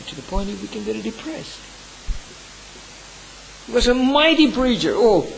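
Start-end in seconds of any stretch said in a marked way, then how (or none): 3.7-5.2 high, ringing praise on mighty; fervent praise. Real, not acted. Pastor extolling Spurgeon to his congregation, fervent and high. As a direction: lift "mighty" high and loud in admiration.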